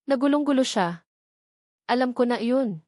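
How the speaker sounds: usual speaking voice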